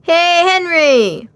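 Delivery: high, loud, drawn-out call; shouted. A narrator's reading. A friend calls out to Henry, loud and high. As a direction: yell his name across the creek. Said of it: "somebody shouted".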